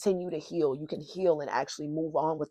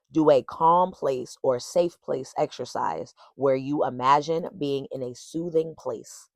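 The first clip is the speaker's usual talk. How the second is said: normal talking voice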